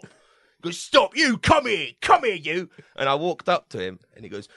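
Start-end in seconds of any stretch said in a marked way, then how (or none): 0.8-2.7 imitated high, angry summons; Ray Winston shouting. Actor summoning a drama student, imitated angry shout. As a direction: order someone over angrily. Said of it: "he was talking like that".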